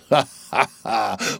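deliberate crazed "ha, ha, ha"; mad theatrical laugh. Acted. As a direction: laugh it out like a touched boy. Mad Pip laughs in soliloquy.

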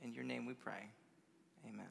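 soft, low prayer voice. Real, not acted. Preacher closing a prayer with the congregation, quiet and reverent. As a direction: pray softly, pausing between phrases.

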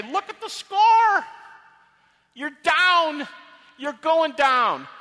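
high, loud, drawn-out crowing; shouted taunt. Shown as a demonstration. Paul taunting death in an auditorium, shouting in triumph. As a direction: crow it like a winner pointing at the scoreboard. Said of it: "He could say".

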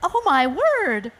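amazed storybook mother; surprised exclamation. Acted. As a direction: exclaim in mild shock. Kangaroo amazed by her chick.

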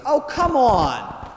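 slow, drawn-out, high exasperated groan. Acted. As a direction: drag it out in disbelief. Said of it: "really ticked off and he's angry".

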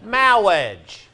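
high, loud, stretched film-quote voice; comic movie impression. Teacher quoting a great movie to laughing students, high drawn-out comic voice. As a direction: intone it high and grand, drawing out the vowels. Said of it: "that's a great movie".

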